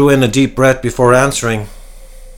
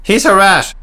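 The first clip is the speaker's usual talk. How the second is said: higher, louder accusing shout; 10 semitones higher, 5 dB louder than usual. A narrator's reading. Cecil shouting at Peter, louder and higher. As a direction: yell it at the crowd. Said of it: "shouted Cecil".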